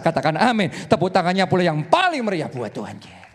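loud, rising preacher climax; full-force preaching. Acted. Preacher rousing the congregation, loud and fervent. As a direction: preach it to the whole hall.

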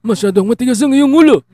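high, loud scolding parent shout; tearful shouted confrontation. Acted. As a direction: yell at the stubborn child. Angry parent, radio drama.

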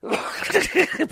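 high breathless giggle; mock schoolgirl giggle. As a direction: titter like a schoolgirl. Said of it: "laughing and giggling like a fucking schoolgirl".

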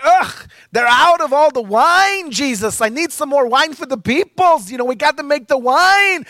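high, loud nagging mother voice; kvetching mother pleading with her son. Retold or imitated.

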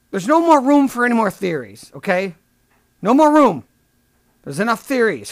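mock exasperated scolding. Comic tells theorists there's no room, mock exasperated.

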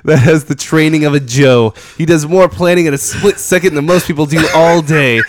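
booming trailer narrator; epic narration. Shown as a demonstration. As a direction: hype it up like a movie trailer. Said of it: "I love you're still reading right now".

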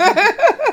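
squealing burst of laughter; high loud laugh. Real, not acted. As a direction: crack up loudly. Laughs at time limit question.

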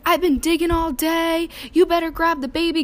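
mock shout to grab the baby; imitating dad. Dad hurrying her to take the baby, loud and high. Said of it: "my dad's like".

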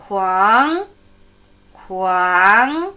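"Huang" said slowly with its pitch climbing at the end; teacher demonstrating the rising tone of "Huang"; rising second tone. Shown as a demonstration. Teacher drills "Huang" with a clear rising second tone. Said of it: "Zweiter ansteigende Ton".

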